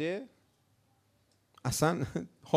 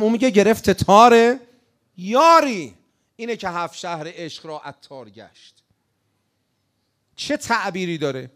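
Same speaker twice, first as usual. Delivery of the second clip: normal talking voice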